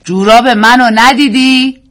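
a husband repeating his question about his socks, slowly. A narrator's reading. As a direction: repeat it slowly as the man who was ignored. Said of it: "مرد دوباره گفت".

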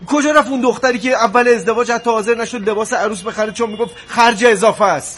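high-pitched, indignant questioning; heated reproach. Acted. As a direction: demand to know what changed in her. Husband reproaches wife over her spending.

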